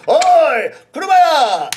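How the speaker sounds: loud hail across the street; calling out. Acted. Customer hailing a rickshaw man, loud call.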